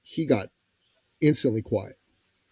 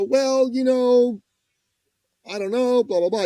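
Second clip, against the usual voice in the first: wavering prospect; 10 semitones higher, 6 dB louder than usual. Retold or imitated. Prospect unsure about signing up for the program, wavering. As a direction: stretch it out in loud mock hesitation. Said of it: "there's people who go".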